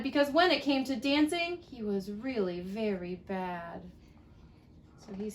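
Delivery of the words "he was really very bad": sad. A narrator's reading. Sad line read to child.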